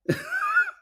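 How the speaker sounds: squealing laugh; a quick high burst of laughter; real high squeal. Real, not acted. Laughs mid-headline.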